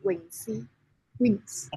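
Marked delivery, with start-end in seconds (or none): 0.0-0.7 "Wingxi" in Cantonese, higher and softer; Cantonese pronunciation. Shown as a demonstration. Guest explaining their English name, "Wingxi" in Cantonese pronunciation. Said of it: "with my Cantonese".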